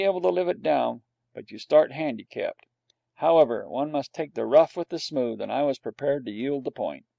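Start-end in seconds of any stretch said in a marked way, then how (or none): none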